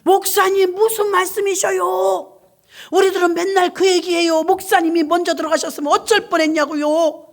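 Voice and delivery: put-on eager old church ladies reassuring their pastor; deaconesses' voice. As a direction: deaconesses in hospital comforting their pastor, high and loud. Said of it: "권사님 두 분이 병원에서".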